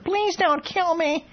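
high, slow, whining plea; mock the condemned with a pouty whine; whiny whimper. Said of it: "he put on a whiny voice, pouted his lips, and whimpered".

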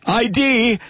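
high-pitched mock realization; mock alarm. Voicing hecklers who get photographed, in mock alarm. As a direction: pop it out high, drawing out the letters. Acting out what hecklers will think.

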